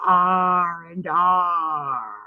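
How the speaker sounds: drawn-out pirate "arr"; pirate growl. Acted. Streamer making a pirate pun to viewers, drawn-out pirate arr. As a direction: stretch the R's like a pirate.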